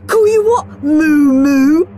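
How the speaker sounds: high singsong mocking taunt; boy teasing Margaret with a nickname. A narrator's reading. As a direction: sneer the nickname. Henry teases Margaret.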